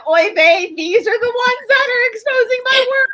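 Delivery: high, loud mock exasperation; exasperated Doc. Doc reacting to who exposes his work, mock exasperated. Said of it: "Doc was probably sitting there going".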